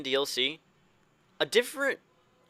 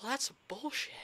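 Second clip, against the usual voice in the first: much higher, whiny protest; mock indignant whine; 12 semitones higher, 5 dB quieter than usual. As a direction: whine it like a sulky kid. Mock outburst after equality speech.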